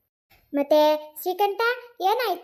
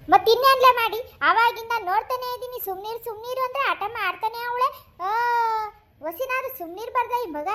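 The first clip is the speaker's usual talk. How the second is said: higher, fed-up grandma; exasperated grumbling; 5 semitones higher than usual. Acted. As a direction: complain at the end of your patience. Grandma fed up with crying child. (ರೇಗುತ್ತಾ)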